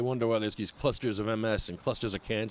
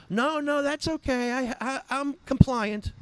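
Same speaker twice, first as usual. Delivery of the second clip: high, dismissive put-on voice of a brushed-off shop owner; mock dispensary owner; 13 semitones higher, slower than usual. Dispensary owner waving off the ramp offer, high mock voice. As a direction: squeak out the excuse in a high mock voice. Dispensary answer acted in quarrel.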